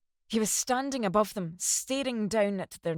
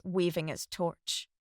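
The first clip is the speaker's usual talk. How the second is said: ordinary delivery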